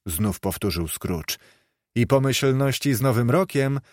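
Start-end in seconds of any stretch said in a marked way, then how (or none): none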